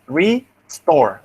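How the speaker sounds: "restore" said as re, pause, store; native syllable split. Shown as a demonstration. Teacher shows how natives think of it, syllable split. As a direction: break "restore" slowly after RE, keeping STORE whole. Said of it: "this is the way they think".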